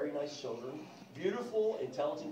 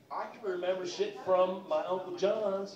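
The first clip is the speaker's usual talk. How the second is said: ordinary delivery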